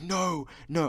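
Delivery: high yelled no, no; mock panicked yell; mock frantic shout. Retold dad banging on glass.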